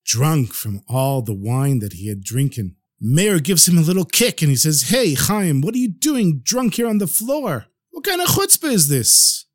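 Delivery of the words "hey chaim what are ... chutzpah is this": rising outrage, high and sharp; indignant scolding. Acted. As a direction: scold the drunk waiter, shocked. Host scolds drunk waiter.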